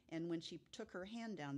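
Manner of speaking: ordinary talk, nothing marked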